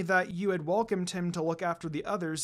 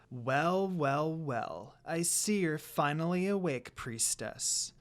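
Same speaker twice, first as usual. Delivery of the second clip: a hint of a smug villainess; 4 dB quieter, slower than usual. A narrator's reading. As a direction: taunt the captive slowly. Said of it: "a female voice said".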